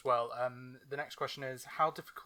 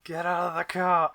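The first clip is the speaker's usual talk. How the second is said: loud, raised-pitch order to get out; mock angry shout; 5 semitones higher, 12 dB louder than usual. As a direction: yell it at someone who shouldn't be there. Acted order to guests in cart.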